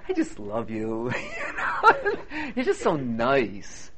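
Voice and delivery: gushing quote broken by laughs; gushing through laughter. Retold or imitated. Pastor imitates Jennifer's praise, laughing as he gushes. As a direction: gush sweetly while laughing. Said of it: "she's like".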